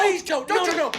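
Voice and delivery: loud, high screamed begging; desperate pleading. Acted. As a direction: scream in horror to stop it. Players beg as pixie is attacked.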